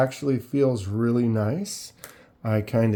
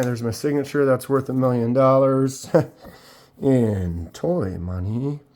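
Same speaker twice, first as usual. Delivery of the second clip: ordinary talk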